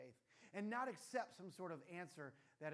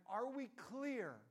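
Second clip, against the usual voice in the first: slow, higher, louder drill-sergeant check; stern dad; 7 semitones higher, 7 dB louder, much slower than usual. Pretend. Dad quizzing his daughter, slow and stern. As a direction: press her to confirm she understood.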